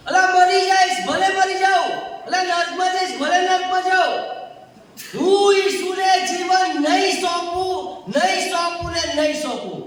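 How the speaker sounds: loud stubborn declaration; defiant refusal. Retold or imitated. Preacher voicing his rebellious younger self, defiant. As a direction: dig your heels in, refuse out loud.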